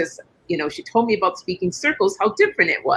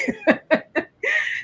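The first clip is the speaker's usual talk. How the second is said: high laugh; 4 dB quieter than usual. Real, not acted. Laughing at her own story.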